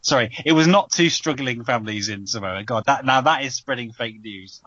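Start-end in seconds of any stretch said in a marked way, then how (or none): none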